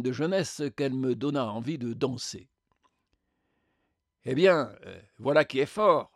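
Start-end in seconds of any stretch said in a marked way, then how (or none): 4.2-6.1 louder, affronted outburst; indignant exclamation. A narrator's reading. Spectre confronts the radiant being, exclaiming. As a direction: exclaim in disbelief at the sheer nerve. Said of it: "s'exclama le spectre".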